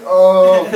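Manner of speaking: heavy groan, re-enacted; loud groan. Preacher overcome by God's presence, groaning at the table. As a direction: groan it out loudly. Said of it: "I was groaning on the table".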